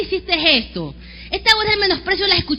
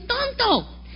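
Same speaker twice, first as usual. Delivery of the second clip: mild angry insult; 6 semitones higher, 5 dB quieter than usual. Retold or imitated. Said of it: "Cuando te pones bravo con tu hijo le dices".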